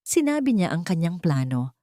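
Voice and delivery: ordinary delivery, nothing marked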